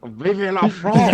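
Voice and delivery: put-on loud, slow French accent cheer. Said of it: "really thick French accent".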